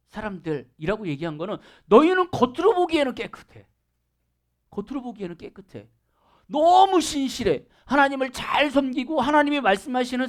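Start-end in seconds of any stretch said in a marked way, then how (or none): 4.7-5.9 quiet knowing echo; soft echo. Shown as a demonstration. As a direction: let 겉으로 보기에는 깨끗해 fall away to almost nothing. Repeated softly as an echo.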